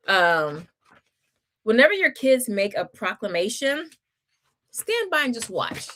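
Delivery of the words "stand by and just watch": ordinary talk, nothing marked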